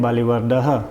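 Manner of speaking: बलिवर्दः with an audible "ha" at the end; sounded visarga. Shown as a demonstration. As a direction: say बलिवर्दः as "balivarda-ha". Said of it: "ह की ध्वनि क्यों निकली".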